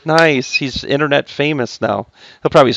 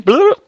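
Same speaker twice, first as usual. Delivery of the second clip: a squeaky high mock bloop noise; silly bloop sound. Teacher adds a sound effect for flipping fractions, squeaky high bloop. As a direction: pop it out like a cartoon bubble. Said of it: "that's the wrong sound".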